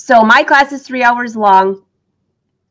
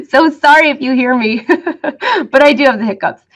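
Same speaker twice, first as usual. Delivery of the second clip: laughing; 4 semitones higher than usual. Real, not acted. Laughing at her own hiccups.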